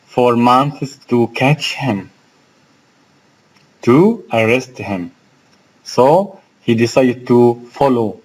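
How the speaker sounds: ordinary talk, nothing marked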